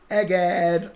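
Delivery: high, loud cry of EGGHEAD; sudden loud, high-pitched exclamation. Acted. Exclaims, then repeats it normally.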